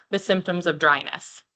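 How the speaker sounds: normal talking voice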